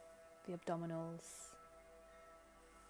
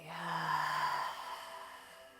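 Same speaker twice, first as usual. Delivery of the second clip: long, audible letting-go exhale; long sigh; 2 semitones lower than usual. Shown as a demonstration. Guide showing listeners how to let everything go, long sigh. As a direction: sigh it all out slowly.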